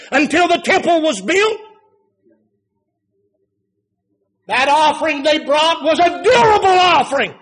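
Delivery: rising pulpit preaching; preaching at full force. Acted. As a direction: drive it home from the pulpit. Preacher building again.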